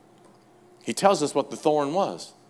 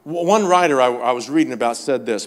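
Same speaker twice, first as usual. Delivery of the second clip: ordinary delivery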